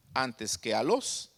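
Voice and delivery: usual speaking voice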